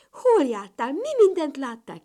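high, loud curious questions; eager questioning. A narrator's reading. Siblings crowding round the traveller, eagerly curious. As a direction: fire off excited questions. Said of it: "kérdezgették, és kíváncsian nézték".

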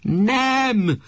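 high sharp cry; shouted no. Acted. Wife shouts her refusal at the husband from bed. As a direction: fling the word out from the bed. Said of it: "Kiabált az asszony".

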